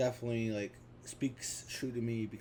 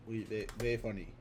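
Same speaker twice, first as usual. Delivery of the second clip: ordinary talk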